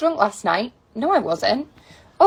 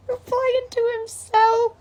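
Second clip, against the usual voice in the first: mock narrator; 11 semitones higher, slower than usual. Mock narrator voice, high slow.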